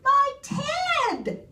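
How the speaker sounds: very high, shocked cry; gasped exclamation. A narrator's reading. Bear recognizing its own teddy, gasping in shock. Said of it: "gasped the bear".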